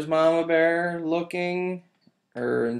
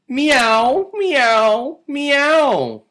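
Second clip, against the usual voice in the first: crying kittens; 12 semitones higher, 9 dB louder, much slower than usual. Acted. Kittens cry.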